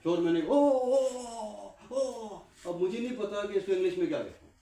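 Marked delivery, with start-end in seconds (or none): 0.5-2.4 high, loud wordless "oh" calls; re-enacted alarm hollering, pitched way up; shouting for attention. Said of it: "اب میں نے شور ڈالنا شروع کیا".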